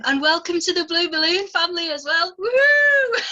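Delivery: loud, high whoop of welcome; host cheers the poet into the family, loud and high; cheering welcome. Real, not acted. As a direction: whoop like you're greeting family. Host cheers new member.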